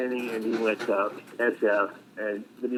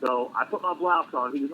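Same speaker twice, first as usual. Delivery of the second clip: ordinary talk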